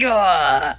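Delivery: a fed-up "ugh" from deep down; disgusted groan. Retold or imitated. Mom reacting to her eaten food, disgusted groan. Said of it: "I'm like".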